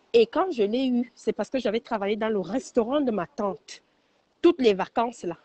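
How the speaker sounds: ordinary talk, nothing marked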